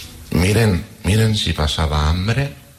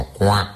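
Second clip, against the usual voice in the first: duck quack; 5 semitones higher than usual. Acted.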